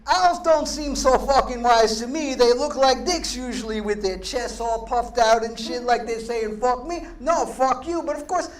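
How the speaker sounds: loud, ranting Long Island Italian poet voice; foul-mouthed nature poet. Acted. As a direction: rant the poem like an outraged tough guy. Character poem reading, "this fucking guy.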